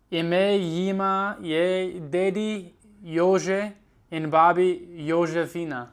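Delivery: slow, halting reading aloud in Slovene; slow careful reading. Acted. Student reads Charlie and the Chocolate Factory aloud, slowly. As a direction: read each word out slowly, syllable by syllable.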